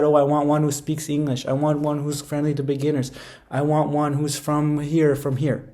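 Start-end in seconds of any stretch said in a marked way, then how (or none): none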